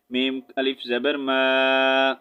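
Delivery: normal talking voice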